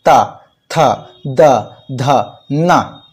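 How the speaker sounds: ता थ द ध न recited, tongue on the teeth; dental consonant row. Shown as a demonstration. Teacher reciting the dental row to show the place. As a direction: run through ता, थ, द, ध, न slowly. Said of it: "दांत से स्पर्श करता है".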